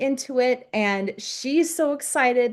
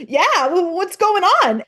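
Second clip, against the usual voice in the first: higher, louder, overacted nonchalance; fake casual; 7 semitones higher, 6 dB louder than usual. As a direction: pretend nothing is up. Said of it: "He's like".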